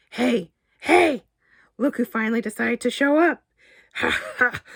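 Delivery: high, loud, hokey greeting with a fake laugh; loud hokey coworker. Red-faced lawyer Barry greets Damien, loud and hokey. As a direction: boom it out like a fake-jolly lawyer.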